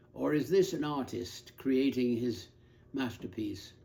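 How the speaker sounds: ordinary talk, nothing marked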